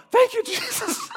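high, bursting laugh through the words; laughing thanks. Retold or imitated. Recalling the doctor's verdict, a high laughing thanks.

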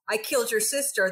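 ordinary talk, nothing marked

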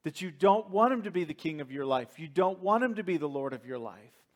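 normal talking voice

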